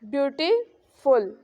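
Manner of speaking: "Beautiful" lifted on beauty, dropped on फुल; rising-falling tone. Shown as a demonstration. Teacher showing students the tone, slow rise then fall. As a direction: stretch "beautiful" slowly, up and then down. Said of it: "वो rise करती है".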